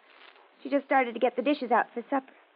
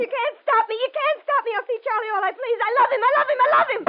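high, raised-voice protest, getting louder; desperate defiance; 8 semitones higher, 5 dB louder than usual. Acted. Daughter insisting on her boyfriend to mother, defiant. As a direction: build to an impassioned outburst.

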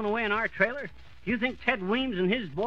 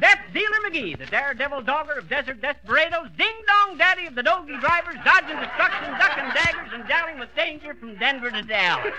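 louder, higher alliterative boast, laughing through it; boastful tall-tale brag; 3 semitones higher, 4 dB louder than usual. Acted. Old marshal bragging to the sheriff, loud and gleeful. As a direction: puff himself up with a rolling brag. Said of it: "I ever tell you".

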